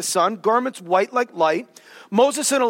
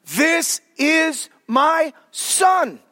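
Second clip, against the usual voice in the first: shouted, much higher, very slow; booming voice of God; 5 semitones higher, much slower than usual. Retold or imitated. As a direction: proclaim it from the mountaintop. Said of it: "God says".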